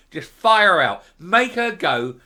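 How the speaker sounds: loud angry call to kick her out; angry shout. Real, not acted. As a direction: shout it out at the voters. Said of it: "I'm really angry".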